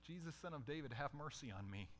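soft, low pleading; quiet plea. Acted. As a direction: murmur the plea for mercy. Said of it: "beggar's plea, contrasted with the yell".

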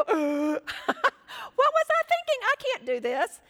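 hammed-up high, loud panicked squeal; mock panic. Doubter looks at the circumstances after stepping out, mock panic. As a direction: play the sudden loss of nerve for laughs.

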